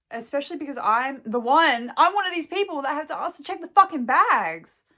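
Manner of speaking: loud, heated venting; really getting worked up about the bags; aggressive rant. Real, not acted. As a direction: aggressive outburst. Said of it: "Sorry for being so, like, aggressive about it".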